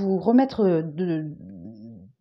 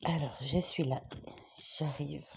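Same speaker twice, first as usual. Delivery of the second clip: calling from across the room; off-mic and much softer; far from the mic; 5 semitones lower, 13 dB quieter than usual. Real, not acted. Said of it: "Pardon pour le micro".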